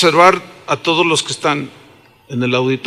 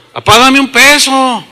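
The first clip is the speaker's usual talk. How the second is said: higher, blunt little demand; demanding child; 6 semitones higher, 4 dB louder than usual. Retold or imitated. Preacher as a boy asking his dad for a peso, demanding. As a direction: hit dad up for money, pitched up and brusque. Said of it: "le pedí así".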